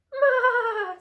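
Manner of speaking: high, loud, wavering bleat; goat bleat. A narrator's reading. Goat noise in story.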